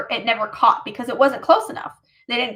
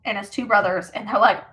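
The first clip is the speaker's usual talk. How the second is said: ordinary delivery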